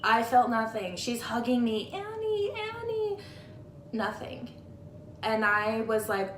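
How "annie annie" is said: imitating a crying mom calling her name, much higher; mock tearful wail, pitched way up; crying mother. Grieving mother hugging the narrator, mimicked high and tearful.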